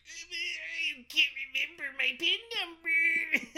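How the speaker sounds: high, loud, hammed-up sobbing; mock crying. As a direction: whimper it like a fake sob through laughter. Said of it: "but she starts crying".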